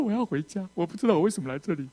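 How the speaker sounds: acted high, whining plea; breaking down. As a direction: wail it like someone wanting to flee home. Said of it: "我整个人崩溃了， 一直哭啊".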